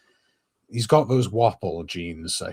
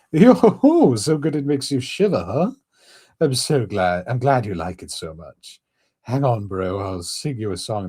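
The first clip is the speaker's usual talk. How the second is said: Stewie Griffin; 5 semitones higher, 5 dB louder than usual. Acted. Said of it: "Now I'm starting to sound like Stewie".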